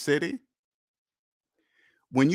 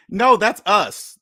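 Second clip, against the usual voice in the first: forceful correction; 5 semitones higher, 7 dB louder, slower than usual. Real, not acted.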